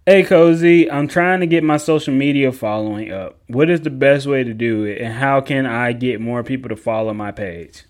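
loud voice for the viewer's question; reading viewer question. Pretend. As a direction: read it out loud like the fan asking.

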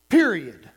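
"Period" hit hard and dropped low; "Period" punched with a firm falling end; emphatic PERIOD. Shown as a demonstration. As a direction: say "Period" as a loud, final full stop. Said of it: "Emphasis on period".

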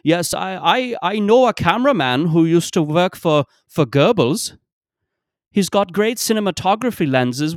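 put-on high, loud German-accented filmmaker voice. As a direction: ham up a German indie director pitching his cameraman. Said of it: "some shitty German indie filmmaker who was like".

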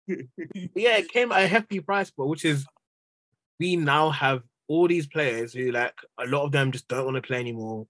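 ordinary delivery

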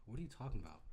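low, soft fake-innocent denial; feigned innocence. Passenger denying it while others sleep, hushed innocence. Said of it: "I hope people are sleeping too".

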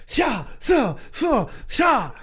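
shouted swooshing sword sounds; sword swish noises. Retold or imitated. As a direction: call out each sword stroke. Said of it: "He was like".

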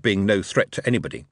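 ordinary delivery

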